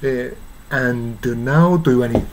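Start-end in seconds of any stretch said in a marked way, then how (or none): 0.7-1.8 "and now" with d and n both distinct; "and now", the d never swallowed; clearly released d. Shown as a demonstration. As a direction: slowly sound out the d, then the n in "and now". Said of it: "doとnuははっきり発音して".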